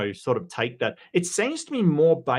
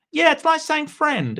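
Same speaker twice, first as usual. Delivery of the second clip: high, bright yeah; agreeing loudly and happily; eager agreement; 14 semitones higher, 4 dB louder than usual. Real, not acted. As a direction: jump in high and keen.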